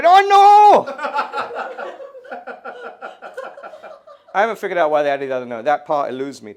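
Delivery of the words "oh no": loud, high mock "oh no" wail; parents' dismay. Parents react to the new baby, mock horrified cry. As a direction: cry it out in horror. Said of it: "my parents told me".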